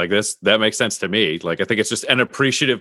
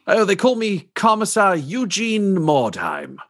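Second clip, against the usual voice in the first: slow, higher-pitched officer announcing a title; grand commissar; 11 semitones higher than usual. Acted. Officer introducing himself to a reporter, slow and grand. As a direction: draw out the name with pomp.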